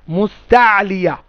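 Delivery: মুস্তালিয়া slow, stretching "taa" and "lii"; drawn-out pronunciation. Shown as a demonstration. Teacher drilling the name of the letters, slow মুস্তালিয়া. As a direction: sound out মুস্তালিয়া syllable by syllable. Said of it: "উচ্চারণ কি?".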